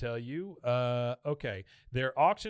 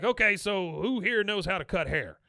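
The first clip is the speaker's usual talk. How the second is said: captain voice; 7 semitones higher, 5 dB louder than usual. Retold or imitated. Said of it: "He's like".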